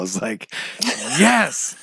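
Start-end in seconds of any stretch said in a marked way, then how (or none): 1.2-1.8 a sudden high, jubilant cry of victory; jubilant yes. Retold or imitated. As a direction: shout it like you just won. Bedroom celebration of a win.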